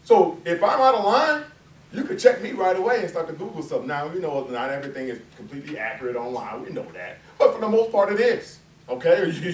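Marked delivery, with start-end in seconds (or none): none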